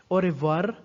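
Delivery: "Au revoir" with tongue-tip Spanish R; wrong-way demo, Spanish R in "Au revoir". Shown as a demonstration. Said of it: "is a Spanish R".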